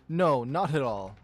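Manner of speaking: defiant lioness. A narrator's reading. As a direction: throw it back at him loudly.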